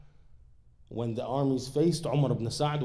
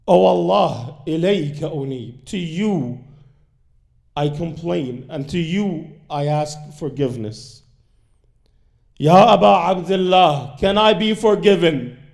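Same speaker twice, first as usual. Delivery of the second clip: loud, higher anguished plea, rising to a cry; crying out for mercy; 4 semitones higher, 7 dB louder than usual. Retold or imitated. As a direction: cry out to God, begging forgiveness. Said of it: "he started screaming".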